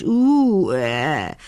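a sleepy dragon's long yawning groan. A narrator's reading. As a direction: yawn it out wide like a huge sleepy dragon. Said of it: "gähnte der Drache".

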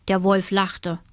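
plain reading voice

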